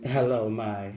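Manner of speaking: low, soft smooth-talker voice; trying out a quiet sweet-talking hello; mock suave greeting. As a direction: croon it low, then leave it hanging. Trying out smooth pet-name greetings.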